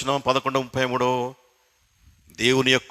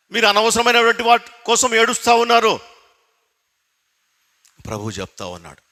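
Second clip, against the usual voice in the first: ordinary talk, nothing marked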